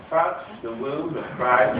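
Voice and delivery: slow, lightly plummy posh Christ; a hint of a posh English gent, drawn out. Christ inviting Thomas to touch, slow lightly posh English. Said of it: "He was actually quite posh in English".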